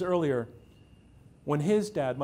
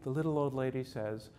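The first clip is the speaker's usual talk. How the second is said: ordinary talk, nothing marked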